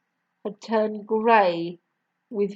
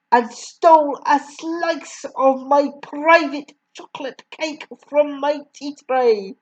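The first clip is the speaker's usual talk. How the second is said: loud, higher ranting accusation; raging headmistress; 4 semitones higher, 6 dB louder than usual. A narrator's reading. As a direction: rant like a furious tyrant. Headmistress rants at the boy.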